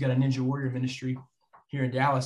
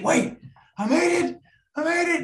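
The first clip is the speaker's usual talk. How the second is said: the dawning joy of having made it; elated disbelief; 5 dB louder than usual. Real, not acted. He realizes the timer left one second, ecstatic.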